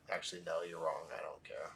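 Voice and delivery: soft and low, sinking to a whisper; dismissive inner reply. Retold or imitated. Student answering his own doubts, quietly dismissive. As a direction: brush it off under your breath. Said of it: "right away, I was like".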